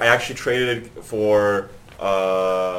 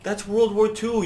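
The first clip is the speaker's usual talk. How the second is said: mocking disbelief; 12 semitones higher, slower than usual. Real, not acted. Mocking friend's printing press idea.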